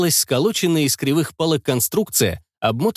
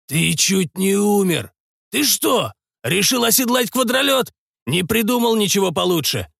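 angry scolding; 7 semitones higher than usual. Acted. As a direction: tell him off sharply. Doc scolding reckless patient.

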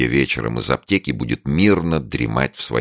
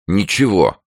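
raised, spiteful retort; vindictive; 8 semitones higher, 4 dB louder than usual. A narrator's reading. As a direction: say it with a touch of vengefulness. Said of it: "сказала Таня мстительно". (мстительно)

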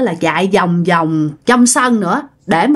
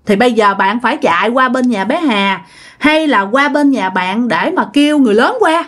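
ordinary delivery, nothing marked

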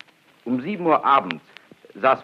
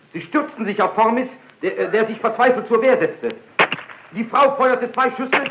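agitated telling; 10 semitones higher than usual. Acted. Narrator tells the murder.

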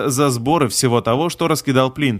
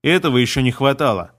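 ordinary delivery, nothing marked

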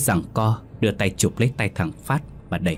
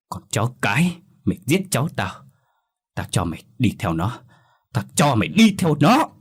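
menacing threat; 3 semitones higher than usual. A narrator's reading. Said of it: "trừng mắt".

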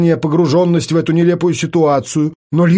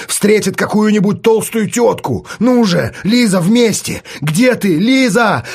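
calling out; 6 semitones higher, slower than usual. Acted. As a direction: call out across the street, pushing her. Artyom calls out in street game. (громкий призыв, выше обычного)

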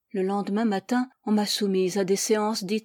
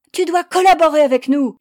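very loud, high command; ferocious order; 7 semitones higher, 12 dB louder than usual. A narrator's reading. Young girl orders the accused to cooperate, ferociously. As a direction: order it fiercely. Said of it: "m'ordonna férocement la jeune fille".

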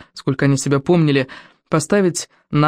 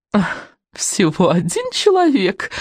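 slow, drawn-out sneer; mocking witch; 3 semitones higher, slower than usual. A narrator's reading. Witch scoffs at the lone volunteer, slow mocking drawl. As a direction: scoff with exaggerated mock amazement. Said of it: "насмешливо отозвалась ведьма".

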